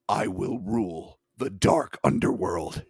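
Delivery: slow, deliberate creature voice; a hint of an ominous alien; creature's proclamation. Creature quote in the account.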